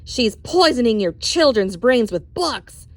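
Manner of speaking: slow, loud accusation to the crowd; loud declaration. Acted. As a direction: denounce her to the mob. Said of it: "Trout declared".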